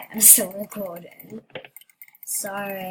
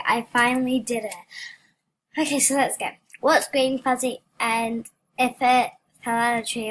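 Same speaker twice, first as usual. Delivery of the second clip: ordinary talk, nothing marked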